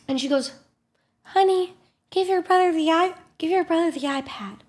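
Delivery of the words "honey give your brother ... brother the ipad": lightly voiced patient mother, pitched up; patient mom. Mom asking son to share the iPad, patient. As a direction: coax gently in a higher mom voice. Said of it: "This mom is patient".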